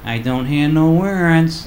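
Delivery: put-on very slow, drawn-out kid reply; child's voice. Kid answering daddy, slow suspicious child voice. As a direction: play the skeptical small kid.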